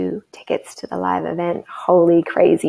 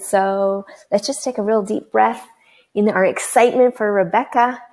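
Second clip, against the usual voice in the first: ordinary delivery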